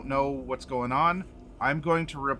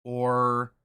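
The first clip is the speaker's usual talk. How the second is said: story narration. A narrator's reading. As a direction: set the scene like a storyteller. Recap narration of last session.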